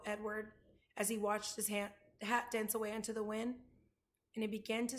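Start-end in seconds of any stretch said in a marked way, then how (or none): none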